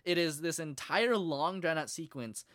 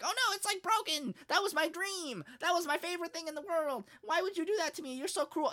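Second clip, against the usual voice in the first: high-pitched mock wail of dismay; upset whining; 11 semitones higher than usual. Boy to girl who broke his mech, upset high-pitched whining. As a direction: plead as if a treasured thing was wrecked.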